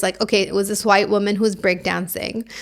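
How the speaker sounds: usual speaking voice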